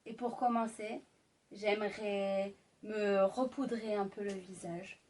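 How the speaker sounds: seduce the listener gently; sultry narrator. Acted. Intimate ASMR roleplay, lipstick.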